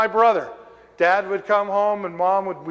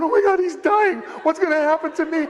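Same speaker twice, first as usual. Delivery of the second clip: mock panic; 11 semitones higher than usual. Boy in his room hearing his brother, high panic. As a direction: freak out like a scared boy waiting his turn. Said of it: "I'm in my room just going".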